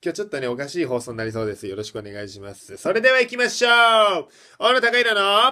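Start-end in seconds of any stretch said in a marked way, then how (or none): 4.6-5.5 high, loud announcer title call; radio title call. Acted. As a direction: belt it out like a radio jingle. (ラジオのタイトルコール)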